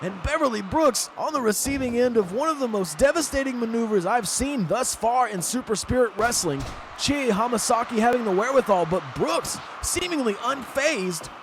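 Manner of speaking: high, awed play-by-play; wrestling commentator. Acted. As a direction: keep the excitement up after the big spot. Commentator stays worked up.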